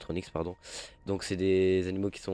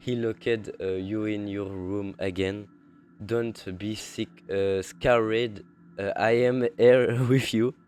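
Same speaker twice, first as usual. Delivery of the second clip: slow, halting English with a French accent; 4 dB louder, much slower than usual. Real, not acted. Gamer reads the intro to viewers, French-accented English. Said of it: "mon accent anglais".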